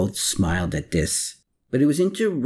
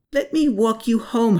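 pressing, much higher offer; insisted; 12 semitones higher, 3 dB louder than usual. A narrator's reading. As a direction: Hermann insists on escorting Anna. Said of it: "Hermann insisted".

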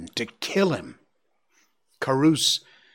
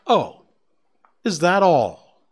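higher, louder scornful brush-off; contempt; 5 semitones higher, 6 dB louder than usual. Acted. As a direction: sneer at the threat. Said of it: "said Ronno with contempt".

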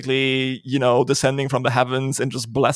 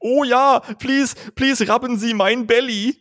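put-on German accent dog; 10 semitones higher, slower than usual. Bernard dog begging in a German accent. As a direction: beg in a silly German accent. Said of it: "with the German accent".

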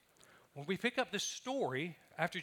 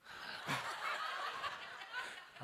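airy wheezing giggle; high breathless laugh; 9 dB quieter than usual. Real, not acted. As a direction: let a high silent laugh escape. Laughs at bumblebee remark.

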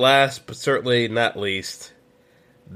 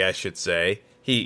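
usual speaking voice

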